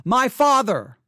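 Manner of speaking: a preacher acting a loud cry to God. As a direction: call out like a child crying to his dad. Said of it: "We cry out to God".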